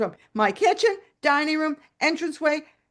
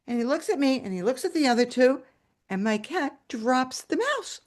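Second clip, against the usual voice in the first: ordinary delivery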